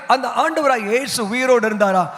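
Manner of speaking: ordinary delivery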